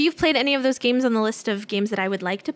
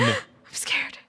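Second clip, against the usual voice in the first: scared whisper; 5 dB quieter than usual. Pretend. Mock fear at zombie trivia.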